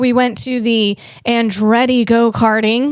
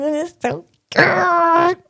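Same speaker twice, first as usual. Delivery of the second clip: higher, slow, voice catching with emotion; touched, near tears; 6 semitones higher, slower than usual. Real, not acted. Vlogger moved by the couples, tearful and slow. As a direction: let it choke up a little from sweetness. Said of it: "I'm melting inside right now".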